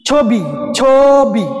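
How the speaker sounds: ছবি drawn out slowly with an o sound, "chho-bi"; pronunciation demo. Shown as a demonstration. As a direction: say ছবি slowly, first vowel a long closed o. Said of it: "মূল উচ্চারণটা কি".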